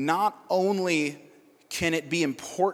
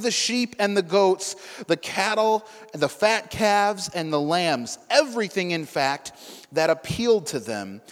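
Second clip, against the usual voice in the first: a steady, slightly raised Bible reading voice; scripture reading; 2 semitones higher, 4 dB louder than usual. Acted. Preacher reading scripture to the congregation, steady and raised. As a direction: read the passage clearly to the room.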